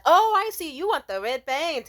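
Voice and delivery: loud, high exclamation of realization; mock the vendor suddenly catching on, loudly; imitated vendor. Imitates the taco man's reply.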